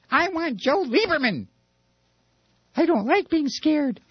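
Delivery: high, whiny mock fright; scared weasel. Weasel wanting Joe Lieberman, scared whine. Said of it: "it's got all the weasels going".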